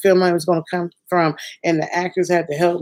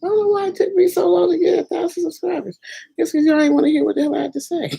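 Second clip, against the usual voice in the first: playful mock complaint; 14 semitones higher, faster than usual. Real, not acted. Joking complaint about subscribers.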